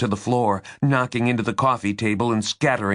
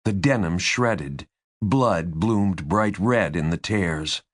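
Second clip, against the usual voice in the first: ordinary delivery, nothing marked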